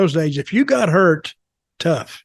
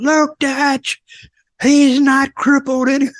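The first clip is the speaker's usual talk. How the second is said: high mimicked boss voice; quoting Bob in a raised voice; imitating Bob; 9 semitones higher, slower than usual. Bob calling to Dutch.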